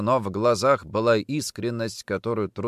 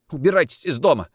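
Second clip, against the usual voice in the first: higher, pleading tearful order; tearful demand; 6 semitones higher, 4 dB louder, faster than usual. A narrator's reading. Wife ordering Nikitin out, tearful demand. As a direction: drive him out through mild tears. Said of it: "плакала жена".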